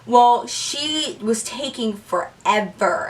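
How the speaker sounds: ordinary delivery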